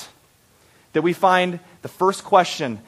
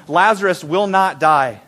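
usual speaking voice

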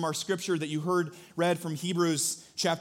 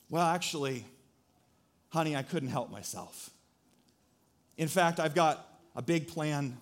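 tender heartfelt reply; 4 semitones lower, 5 dB quieter than usual. Retold or imitated. Husband tells wife he'd rather spend the evening with her, tender and soft. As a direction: speak it gently, meaning every word. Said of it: "Now imagine instead I respond".

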